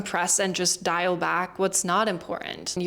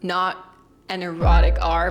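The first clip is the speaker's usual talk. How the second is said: "erotic" punched louder as the wrong word; stress on erotic; 6 dB louder than usual. Shown as a demonstration. Teacher clearing up a mix-up, loud stress on "erotic". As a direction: hit "erotic" loud to set it apart. Playful contrast with rhotic R.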